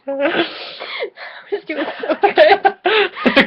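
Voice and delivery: words squeezed out through laughter; losing it while trying to read; giggling high and slow; laughing. Real, not acted. Cracking up mid-reading.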